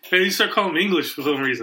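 ordinary delivery, nothing marked

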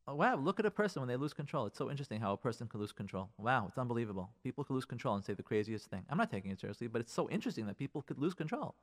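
soft, low musing to oneself; hushed, unbothered fascination; detached wonder. Acted. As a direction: calmly marvel from a distance. Detached inner observation of spouse.

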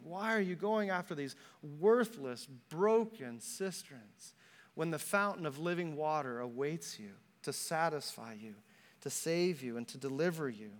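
soft, low appeal; hushed pleading. Acted. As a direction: plead gently, letting the voice sink. God pleads with his people.

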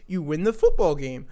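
ordinary talk

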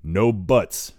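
loud and sharp. A narrator's reading. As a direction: cut him off hard. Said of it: "he says sharply".

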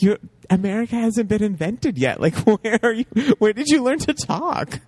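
high, amused disbelief with a laugh breaking in; laughing, high mock interrogation; mock incredulity. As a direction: challenge them in high-pitched comic bafflement. Mock challenge to the actress's accent.

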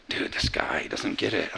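low whispered aside, acted out; whispered grumble. Fishermen complain among themselves about Jesus, whispered grumble. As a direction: grumble under your breath in a whisper.

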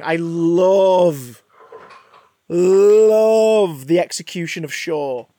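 gushing about the scene. Real, not acted. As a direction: savor every word.

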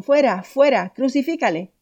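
crowd shouting. Acted. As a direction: cry it out like a furious crowd. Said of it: "Entonces ellos gritaron".

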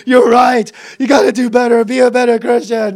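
loud, hammed-up self-reproach; guilt-ridden self-scolding. Preacher acting out the guilt response, loud mock self-scolding. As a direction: berate yourself loudly in mock guilt.

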